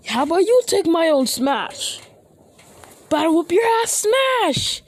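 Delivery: high, loud attack shout; battle cry. Acted. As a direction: yell it like a finishing move. Acted attack cries in fight.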